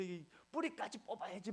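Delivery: high, put-on voice of a scolding old man; scolding grandfather. Grandfather scolding the pastor over the lettuce roots, high put-on cranky voice. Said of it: "막 그러면서 엄청 또 욕을 하시는 겁니다".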